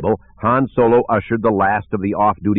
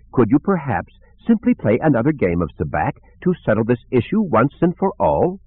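high, fussy droid voice, politely interrupting; a prim protocol droid squeezing in a suggestion; C-3PO; 4 semitones higher than usual. Acted.

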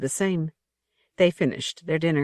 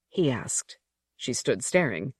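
plain reading voice

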